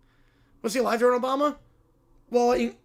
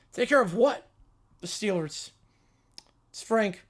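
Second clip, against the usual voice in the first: usual speaking voice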